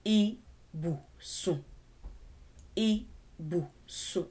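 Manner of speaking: I, bu, sun split apart, falling on sun; sounding out syllables. Shown as a demonstration. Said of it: "you have to know the letters".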